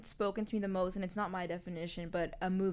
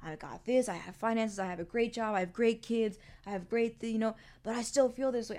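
louder, puzzled venting father; mild mock of a frustrated dad; imitating her father; 3 semitones higher, 6 dB louder than usual. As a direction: play the dad who can't see why he's down. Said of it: "He still calls me and he's like".

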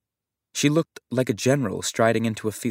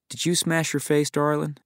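put-on light Texan accent, higher and lilting; Texan drawl; 7 semitones higher than usual. Aunt cooing at the injured girl in a Texan drawl. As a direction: tease the child in a mock Texan accent. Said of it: "She said it with a Texan drawl".

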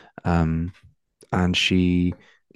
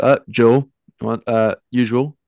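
louder, higher barman's greeting; lightly voiced bartender, raised voice; bartender hailing a regular; 5 semitones higher, 7 dB louder than usual. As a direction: call out to a regular at the bar.

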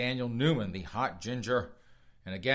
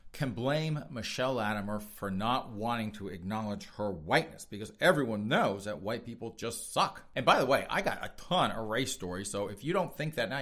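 ordinary delivery

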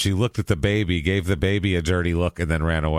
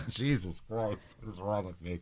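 embarrassed mutter; 14 dB quieter than usual. Real, not acted. After mixing up green room and waiting room, a soft embarrassed mutter. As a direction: drop it to a rueful near-mutter. Laughing at her own slip.

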